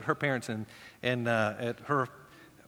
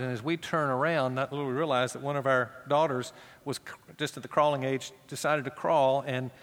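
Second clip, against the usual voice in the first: usual speaking voice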